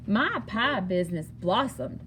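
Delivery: ordinary delivery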